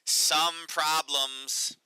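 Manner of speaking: slow, drawn-out mocking stress on "some"; sarcastic air quotes. Reading the headline aloud to viewers, sarcastic and drawn out. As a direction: stretch "some problems" slowly as if rolling your eyes. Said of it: "There's, quote".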